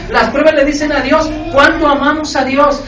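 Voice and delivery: loud, driving sermon delivery; full-force preacher; fiery preaching. Real, not acted. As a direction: preach it hard to the congregation.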